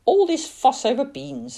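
a lightly voiced aunt, exasperated; scolding Auntie May. A narrator's reading. Aunt scolding Billy for crying over beans, exasperated. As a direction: tut at the fuss like a weary aunt.

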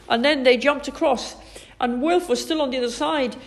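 plain reading voice

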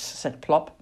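ordinary delivery, nothing marked

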